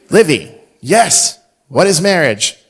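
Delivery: hammed-up game-show ask; playful quiz. Dad teasingly quizzing his younger daughter, loud.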